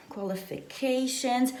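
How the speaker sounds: ordinary delivery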